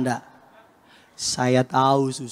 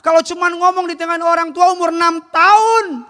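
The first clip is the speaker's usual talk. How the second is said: insisting; 4 dB louder than usual. Retold or imitated. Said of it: "Saya bilang".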